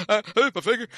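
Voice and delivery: high, loud mock yelp of pain. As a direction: squeal it like a cut finger. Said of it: "that voice you just did".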